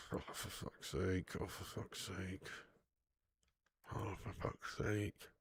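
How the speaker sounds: soft, slow, defeated mutter under the breath; muttered despair. Real, not acted. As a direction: sigh the words out, barely voiced. Muttering at the match.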